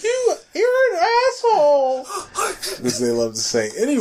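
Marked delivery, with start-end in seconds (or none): 0.0-2.0 high, loud, laughing shriek; mock shrill girl yell. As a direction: squeal it through laughter like an offended girl. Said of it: "she was like".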